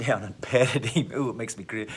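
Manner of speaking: ordinary talk, nothing marked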